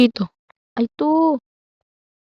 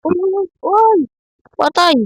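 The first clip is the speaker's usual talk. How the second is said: sudden alarmed yelp; startled high cry; 9 semitones higher than usual. Real, not acted. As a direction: jump at a scare. Sudden scare in game. (gulat na sigaw)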